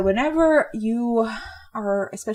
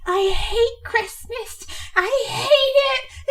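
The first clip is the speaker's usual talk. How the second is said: put-on high, slow, crying whine of a sulky kid; whining child Grinch; slower than usual. Imitating young Grinch climbing the mountain, high crying whine. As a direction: wail it like a little child on the verge of tears. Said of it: "little child voice is whining".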